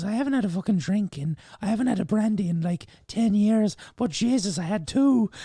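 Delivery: high, lilting Irish granny; gossipy old Irish grandmother; Irish old lady voice. Retold or imitated. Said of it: "that's my Irish old lady voice".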